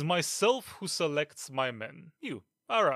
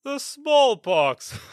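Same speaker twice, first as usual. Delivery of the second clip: slow, loud, high scoff; mock the excuse openly; scornful disbelief; 9 semitones higher, 6 dB louder, much slower than usual.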